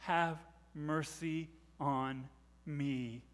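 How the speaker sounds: slow, low, soft plea; hushed plea. Acted. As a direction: say each word softly, drawn out. Hushed repeat after the shout.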